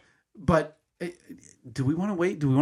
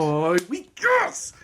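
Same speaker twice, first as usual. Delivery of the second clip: excited cheer; 2 semitones higher, much slower than usual. Real, not acted.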